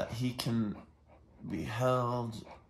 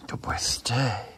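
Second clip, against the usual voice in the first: whispered command; slower than usual. Real, not acted. Trainer whispers to the dog to stay. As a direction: whisper it so the dog stays calm.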